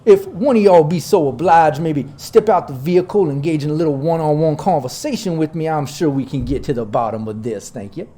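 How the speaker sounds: genteel Dixie drawl, starting loud and settling down; Southern drawl. Acted. Local boss asking someone to step out, Southern drawl. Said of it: "my southern drawl got a little bit of that Dixie charm".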